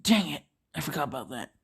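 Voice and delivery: muttered aside, dropping very soft; hushed admission to oneself; whispering. Acted. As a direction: curse softly, then trail into a whisper. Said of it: "Deku whispers".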